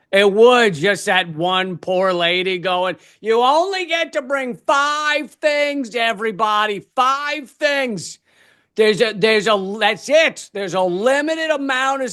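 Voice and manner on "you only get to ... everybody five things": high, drawn-out crowd-control call; harried announcer. Acted. One poor lady telling all humans to pack light, harried announcer. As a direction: call it out slowly over a crowd.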